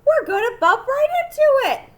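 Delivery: high, loud alarmed yell; panicked shout. A narrator's reading. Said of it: "he cried".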